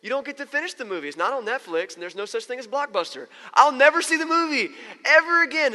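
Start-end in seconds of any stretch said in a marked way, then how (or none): 3.5-5.7 loud, high, hammed-up outcry; mock despair. Viewer who had to leave for work, mock despair. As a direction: wail it loudly in mock despair.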